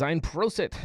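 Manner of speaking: cheery German toast. Real, not acted. Reading a German toast aloud.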